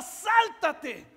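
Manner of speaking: shouted father's order; father yelling. Acted. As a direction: urge him to leap now. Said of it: "Y el papá gritaba".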